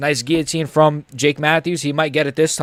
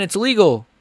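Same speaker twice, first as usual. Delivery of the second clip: normal talking voice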